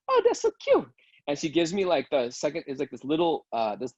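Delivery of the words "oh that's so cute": very high, louder gushing falsetto; hammed-up high squeal of delight; mock swooning. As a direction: gush like a charmed clerk, way up high. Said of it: "And she goes".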